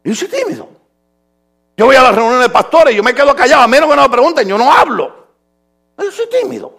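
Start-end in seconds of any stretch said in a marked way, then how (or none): 0.0-0.7 high, small mock-shy voice. Shown as a demonstration. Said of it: "yo tengo una personalidad tímida". 6.0-6.7 high, small mock-shy voice. Shown as a demonstration. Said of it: "yo tengo una personalidad tímida".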